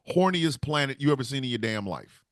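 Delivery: ordinary talk, nothing marked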